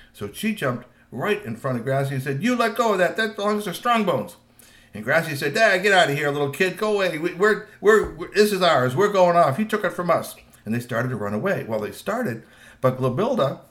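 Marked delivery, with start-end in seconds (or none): 2.4-4.4 put-on higher-pitched scolding child troll; indignant demand. A narrator's reading. Girl to teen troll grabbing the backpack, indignant. 5.5-10.4 louder, higher, impatient retort; dismissive teen troll. A narrator's reading. Teen troll to a little girl in his way, dismissive.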